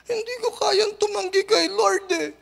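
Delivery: high tearful voice; crying. Retold or imitated. Woman forgiving her friend, tearfully pleading to pastor. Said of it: "And then she starts to cry".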